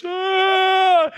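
high loud gasp of delight; a sudden thrilled "ah"; startled gasp. Acted. God reacting as you wake.

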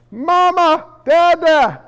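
drawn-up, high kiddie cry for attention; child calling parents. Acted. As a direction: call out for mama and dada like a small child. Acting out small children calling parents.

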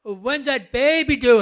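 loud, bright blurted question; cheerful loud question. Retold or imitated. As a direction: blurt it out happily. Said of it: "and he says".

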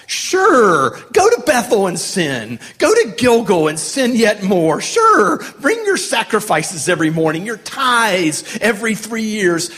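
scripture read as a sarcastic buddy would say it; sarcastic taunt. Acted. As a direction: taunt them with exaggerated fake approval. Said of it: "listen to his voice, because it starts with a taunt".

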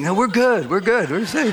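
high, singsong mock reassurance breaking into a laugh; comic high voice of men waving from afar; men waving at king. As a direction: playfully call back that all is fine. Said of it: "they're probably waving at the king".

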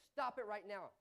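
put-on stern order to a child; commanding. Shown as a demonstration. Preacher demonstrates ordering Charlotte to stop, firmly. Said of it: "by the tone of my voice".

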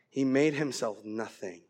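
very low, slow, fading to a whisper; solemn near-whisper; hushed reverence. Real, not acted. Solemn climax on self-giving.

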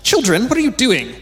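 put-on high, loud talking down to teens; a sing-song grown-up scolding teenagers; patronizing. Shown as a demonstration. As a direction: condescend like addressing small kids. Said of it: "Two totally different tones".

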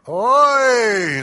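Oi! barked loud and high; Hebrew oi. Shown as a demonstration. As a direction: cry Oi! as a sharp, loud lament. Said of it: "Say it with me".

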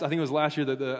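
ordinary talk, nothing marked

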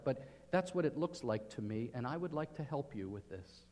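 gentle counsel. Retold or imitated. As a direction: speak it gently, like a friend offering help. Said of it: "someone came to you and said".

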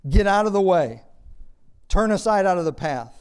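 loud, high shouted order; acted shout of rejection. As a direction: shout the prophet out of your way. Acts the people rejecting the prophet.

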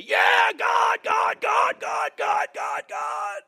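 high, full-force chanting shout of a worked-up believer; over-the-top revival yell; mock fervent yelling. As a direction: imitate someone yelling wildly for God. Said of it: "yelling for God one day".

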